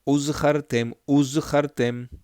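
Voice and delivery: Uzchartem, the z and guttural ch kept apart; careful z ch. Shown as a demonstration. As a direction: press the z then the throaty ch in uzchartem. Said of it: "Be careful with that".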